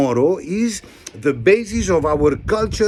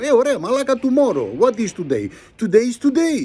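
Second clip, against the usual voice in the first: mock Greek worker; 6 semitones higher, faster than usual. Worker retorts.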